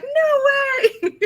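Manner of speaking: very high, loud squeal; amazed disbelief. Retold or imitated. Reading group learning their teacher wrote the book, astonished. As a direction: imitate kids shrieking in astonishment.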